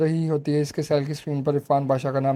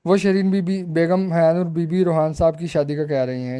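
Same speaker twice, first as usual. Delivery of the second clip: ordinary delivery, nothing marked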